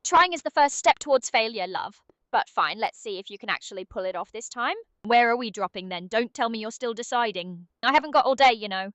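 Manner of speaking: put-on impatient British accent; brisk British nudge to get moving; British Aria. Game sidekick hurrying the player to drop, impatient British. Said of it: "Can British Aria come back for a bit?".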